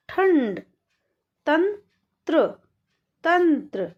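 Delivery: each word drawn out slowly, then said again normally; slow then normal reading. Shown as a demonstration. Teacher reading word pairs slowly, then normally. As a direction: stretch the word, then repeat it plainly. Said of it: "तो कैसे पढ़ेंगे?".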